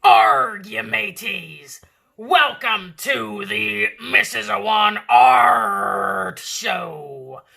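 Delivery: loud, slow pirate greeting; booming pirate welcome to the art show. Acted. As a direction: roar it out like a captain. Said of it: "Will I be able to talk like this".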